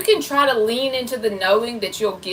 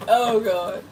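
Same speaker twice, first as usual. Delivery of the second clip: normal talking voice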